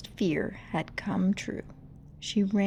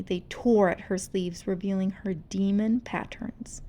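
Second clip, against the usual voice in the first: ordinary delivery, nothing marked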